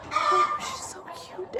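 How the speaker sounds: high slow coo into a whisper. Real, not acted. Praising mother with lamb.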